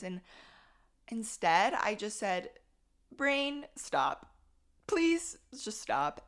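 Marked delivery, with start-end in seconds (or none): 3.1-6.2 acted plea to her own brain, raised and louder; high "Brain", dropped "stop", then a drawn-out "please"; pleading command.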